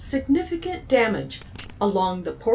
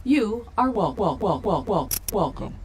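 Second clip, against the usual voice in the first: glitching stutter. Acted. Malfunctioning robot stutter.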